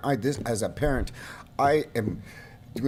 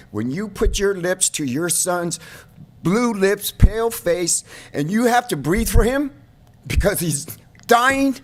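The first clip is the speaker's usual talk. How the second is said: rising, louder and higher, anguished outcry; anguished shouting; 6 semitones higher, 7 dB louder than usual. Real, not acted. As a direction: pour out the grief in a swelling yell. Father describing reviving dying son.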